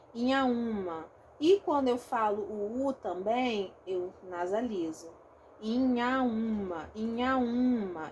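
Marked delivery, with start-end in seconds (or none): 5.6-8.1 slow Inhaúma with nasalized I and U; nasalized vowels. Shown as a demonstration. Teacher showing the class the nasal inhaúma, slowly.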